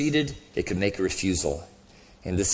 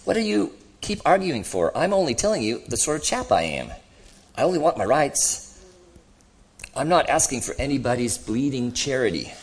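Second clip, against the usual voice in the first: higher-pitched, exasperated ghost; indignant ghost; 7 semitones higher than usual. Acted. Ghost arguing with the other, indignant. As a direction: protest as if wrongly accused.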